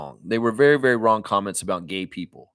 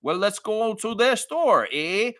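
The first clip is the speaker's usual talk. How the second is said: hammed-up Canadian voice, higher, leaning on every "eh"; mock Canadian accent; 8 semitones higher, slower than usual. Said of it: "Or you talking about".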